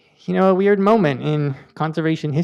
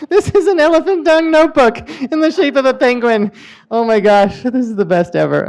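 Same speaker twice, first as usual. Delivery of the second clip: high, loud, thrilled; delighted opening a present; 6 semitones higher, 4 dB louder than usual. Real, not acted. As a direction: gush over a wonderful gift. Delighted by penguin notebook.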